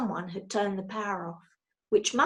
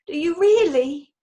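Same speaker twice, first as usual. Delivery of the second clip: higher, louder hopeful bear voice; a tearful bear hoping; touched bear; 7 semitones higher, 9 dB louder than usual. A narrator's reading. Paddington hearing he's wanted, hopeful and tearful.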